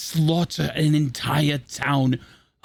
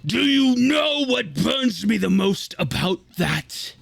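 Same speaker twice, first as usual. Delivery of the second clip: ordinary talk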